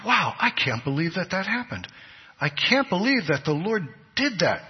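playing a stunned listener's amazement; amazed reaction. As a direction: say it as if you can hardly believe it. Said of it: "you say".

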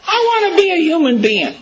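a high, longing dog's wish; eager dog. Acted. A dog telling itself what it wants, high and longing. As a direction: sing out the dog's dream.

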